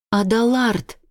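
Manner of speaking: a sudden high shout. Acted. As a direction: call his name out in alarm. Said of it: "кричу я".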